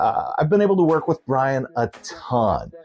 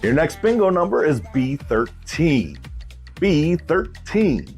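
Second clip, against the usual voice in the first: bingo caller; 4 semitones higher than usual. Acted. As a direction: drag out the repeat. Calls bingo number.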